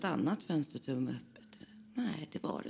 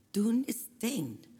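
the door is shut, say it high and loud, slowly; a jolt of alarm at the door; high, slow alarmed line; alarmed outburst; 8 semitones higher, 5 dB louder than usual. A narrator's reading. Dramatic discovery in story.

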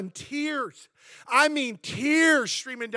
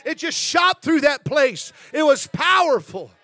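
loud, fervent preaching; full-force preaching; 4 semitones higher, 7 dB louder than usual. Acted. Pastor tells the church about revival at breakfast, loud and fervent. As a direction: drive it home with fire.

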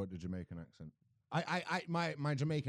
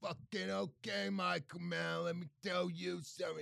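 higher, slow, stretched-out comedian impression; Tom Stade; 6 semitones higher, slower than usual. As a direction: drag the words out in a put-on stand-up voice. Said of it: "I could do Tom Stade".

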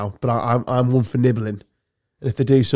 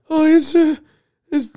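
mock caller. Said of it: "I'm gonna make a name up now".

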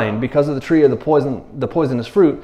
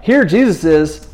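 normal talking voice

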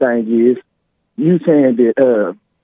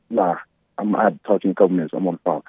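quiet aside; 6 semitones lower, 6 dB quieter, much faster than usual. Real, not acted. Caller aside to someone nearby.

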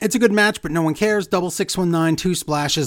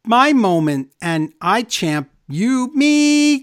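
halting, stretched-out words jumping high; hammed-up botched promo, lurching slowly upward; mock halting promo; 5 semitones higher, 3 dB louder, much slower than usual. Mocking Dana Brooke's backstage interview, halting and high.

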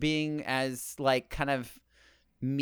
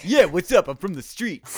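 fake tough guy swagger; 5 semitones higher, 9 dB louder than usual. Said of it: "Oh, Street [name]'s back".

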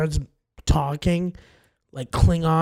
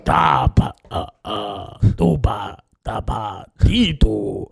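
Jabba the Hutt gibberish; 10 semitones lower, slower than usual. Retold or imitated. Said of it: "It was Jabba the Hutt".

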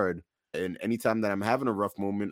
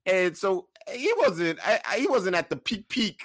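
higher and building, laughing by the end; getting giddy as the story peaks; excited; 11 semitones higher than usual. Real, not acted. Telling the co-host how his brother slid across the floor, giddy and rising.

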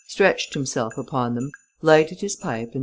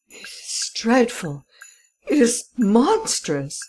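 anguished lament; 7 semitones higher, slower than usual. A narrator's reading. Baron laments his loss.

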